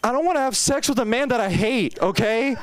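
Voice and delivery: high, frantic explanation; quitting the gym at the front desk, frantic; exasperated outburst. Retold or imitated. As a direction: blurt it out high and desperate. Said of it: "And I'm like".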